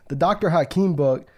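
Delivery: normal talking voice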